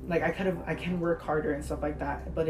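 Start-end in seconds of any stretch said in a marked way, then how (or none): none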